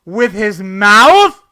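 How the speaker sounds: loud mocking outrage; incredulous rant. Real, not acted. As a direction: yell it like the line offends you. Ranting about the line.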